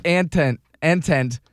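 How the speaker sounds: "Antent", two syllables ending like tent; mispronounced Antoinette. Retold or imitated. Trivia host recalling how he misread it, mispronounced. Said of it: "I think I said".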